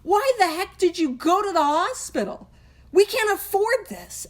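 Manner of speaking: high, incredulous scolding; mock outrage at her husband; exasperated wife. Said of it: "Lois, his wife, was like".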